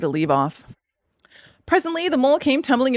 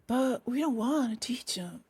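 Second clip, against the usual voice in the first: soft, breath-heavy little Mole; voiced Mole; 3 semitones higher, 11 dB quieter, slower than usual. A narrator's reading. Mole objecting to Rat, soft and slow. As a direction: object softly, almost whispering. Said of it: "replied the Mole".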